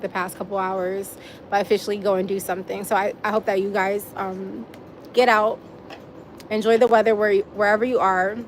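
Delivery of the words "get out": ordinary talk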